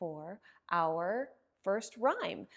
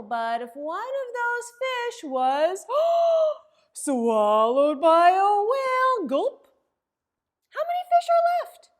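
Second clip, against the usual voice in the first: gasping, much higher and louder whale drama; dramatic gasp; 9 semitones higher, 14 dB louder than usual. Acted. Librarian acting shocked as the whale swallows a fish, gasping. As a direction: make the kids jump at the whale.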